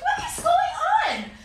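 very high, slow disbelief; mock-incredulous high wail; baffled squeak. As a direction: stretch it out, stunned. Baffled at Starbucks meeting.

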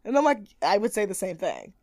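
normal talking voice

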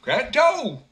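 high, loud simpleton; blurted cheerful reply. A narrator's reading. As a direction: simpleton shrugging to old man. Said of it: "replied the simpleton".

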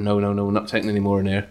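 lightly put-on Suffolk accent; a hint of a Suffolk car park attendant. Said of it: "This is my random Suffolk voice".

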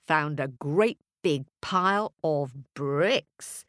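plain reading voice

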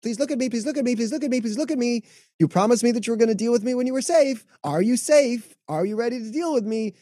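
high, eager little plea; past trauma speaking. Acted. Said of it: "that's your past trauma saying".

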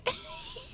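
a burst of high, squealing laughter; high giggle. Real, not acted. Friend cracking up over the bath scene, high giggle. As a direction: giggle helplessly at the joke.

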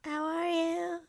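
very high, drawn-out fake effect voice; mock distorted voice. Shown as a demonstration. Guest greeting the host, high mock distorted voice. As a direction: hold the words long and high like a processed voice. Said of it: "trying to, like, di-, um, distort my voice".

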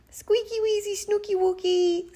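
put-on very high, squeaky baby-talk; cooing aunt. A narrator's reading. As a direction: coo silly pet names in a tiny high voice. Aunt cooing pet names.

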